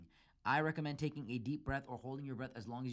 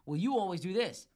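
mock blaming yell; 7 semitones higher, 9 dB louder than usual. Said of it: "They start bringing stuff up".

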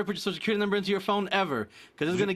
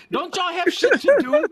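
higher, louder fed-up yell; exasperated shout; 11 semitones higher, 8 dB louder than usual. Real, not acted. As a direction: shout it in disbelief. Exasperated rant at chat.

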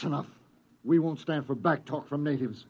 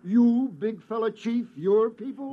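condescending pidgin to a stranger; pidgin talk to a native; 6 semitones higher, 4 dB louder than usual. Acted. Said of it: "There's no need to talk that way".

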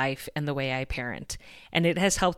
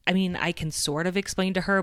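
ordinary delivery

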